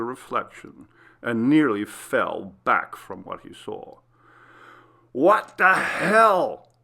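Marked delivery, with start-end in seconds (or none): 5.1-6.7 shocked, drawn-out yell; slow stunned shout of disbelief; scream. A narrator's reading. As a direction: recoil from the mirror and yell. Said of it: "he screamed".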